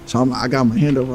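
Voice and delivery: ordinary talk, nothing marked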